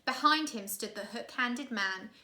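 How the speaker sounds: plain reading voice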